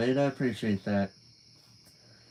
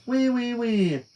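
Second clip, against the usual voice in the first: mocking squeal; 9 semitones higher, slower than usual. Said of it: "she said".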